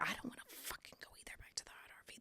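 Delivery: fast whispered true thought; quick hushed confession; secret whisper. Retold or imitated. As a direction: rush it out as a hidden whisper. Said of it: "That's what I really wanted to say".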